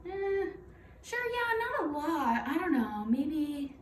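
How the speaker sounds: high, hesitant, offhand shrug of a reply; unsure patient. Retold or imitated. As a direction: play a new mom brushing off the question, unsure. Patient's vague bleeding report acted out.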